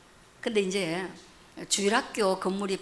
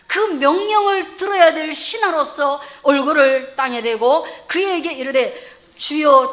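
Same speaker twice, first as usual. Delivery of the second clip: loud, declamatory scripture retelling; raised, emphatic preacher voice; forceful preaching; 5 semitones higher, 10 dB louder than usual. Real, not acted. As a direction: act out the Bible scene from the pulpit.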